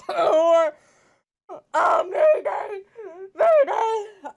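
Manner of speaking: high loud disgusted cries; panicked shout. Real, not acted. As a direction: yell mayday in real disgust. Gross bean panic.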